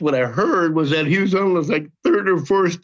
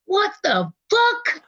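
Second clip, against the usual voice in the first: slow, high-pitched incredulous question; drawn-out, pitched-up retold shock; stunned disbelief; 14 semitones higher, much slower than usual. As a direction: stretch each word in mock astonishment. His retold disbelief at the news.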